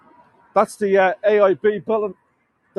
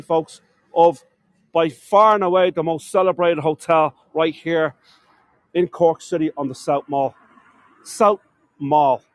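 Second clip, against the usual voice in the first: ordinary delivery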